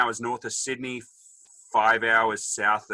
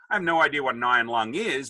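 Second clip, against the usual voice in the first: ordinary delivery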